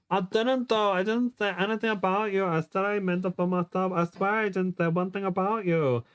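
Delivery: high, insistent self-defence; pleading protest. Acted. Heroine denies telling her boyfriend, high pleading protest. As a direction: swear your innocence in a high voice.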